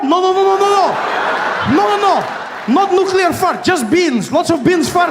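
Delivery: very high, frantic protesting; hammed-up desperate pleading; mock panicked denial. Iran denies the nuclear accusation to America, mock panic.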